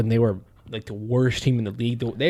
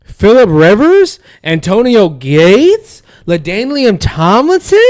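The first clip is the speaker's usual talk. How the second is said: slow, loud, every name punched; emphatic shout; 9 semitones higher, 12 dB louder, slower than usual. Real, not acted. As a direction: hammer each name home. Listing rival's star players emphatically.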